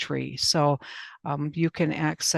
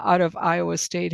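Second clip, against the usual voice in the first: usual speaking voice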